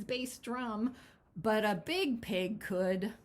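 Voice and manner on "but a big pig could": usual speaking voice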